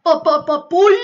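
quick playful creature yelp, not far from own voice; Stitch impression. Streamer showing off his Stitch impression, lightly voiced. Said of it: "impression of Stitch".

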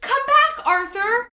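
high, loud pleading call; crying out. A narrator's reading. Henry calling to the escaping butterfly, loud cry. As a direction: call after someone getting away. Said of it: "cried Henry".

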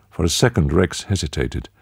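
plain reading voice

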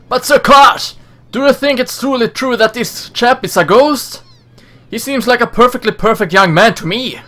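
high, fussy henchman voice; deferential henchman. Acted. Henchman doubting Sir Kosh about the ghost, high deferential voice. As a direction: question the boss in a high character voice.

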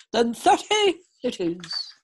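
slow, loud hearty king; folksy old sitcom dad. A narrator's reading. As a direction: seal the deal like a jolly king. King agreeing in story.

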